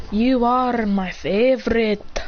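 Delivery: drawn out very slowly, savouring each word; slow adoring declaration. Acted. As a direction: coo it slowly like a child hugging a pet.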